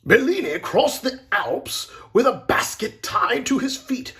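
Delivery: loud, high showman's list of marvels; awed boasting. A narrator's reading. Agent lists Bellini's daring feats to the others, excited. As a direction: build each feat bigger, high and loud. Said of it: "the agent said".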